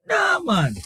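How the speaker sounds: high, exasperated "Nah, man" flung upward; emphatic dismissal. Real, not acted. Host waving off New Zealand's play, pitched high in disbelief.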